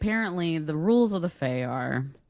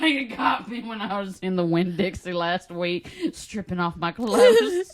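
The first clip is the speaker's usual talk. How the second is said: mock confession; 4 semitones higher than usual. Joking about being glamored in the Winn-Dixie, hammed up. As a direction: play a glamour victim, voice rising high.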